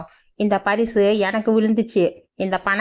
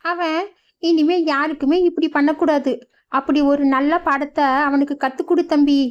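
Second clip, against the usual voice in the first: put-on higher, urging old mother; old mother's voice; 6 semitones higher than usual. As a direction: press him to teach a lesson. Mother urges lesson.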